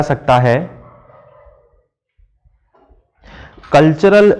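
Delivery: slow, deliberate dictation with pauses; classroom dictation. Shown as a demonstration. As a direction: read it out slowly for students to write down. Dictates the question slowly. (धीरे-धीरे सवाल लिखवाते हुए)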